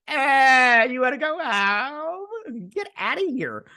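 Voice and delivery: very high, drawn-out jeering shout; mocking wail. Viewer jeering at the crying character, loudly mocking. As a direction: mimic the crybaby in an exaggerated high wail. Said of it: "and I'm like".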